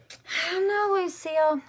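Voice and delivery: high, loud coy tease; coy teasing. Acted. Junie B teasing Lucille about winning, coy and high.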